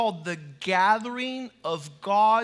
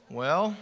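"Well" rising like a question; "Well" with a question mark at the end; 4 semitones lower than usual. Shown as a demonstration. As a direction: lift "Well" upward as an attentive listener. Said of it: "there's a question mark at the end".

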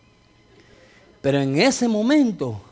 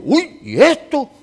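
higher and louder, slow amazed exclamation; astonished gasp; 7 semitones higher, 6 dB louder, slower than usual. Retold or imitated. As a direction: sound truly stunned by the miracle. Pastor ve sanarse las llagas. (asombro, más agudo, fuerte y lento)

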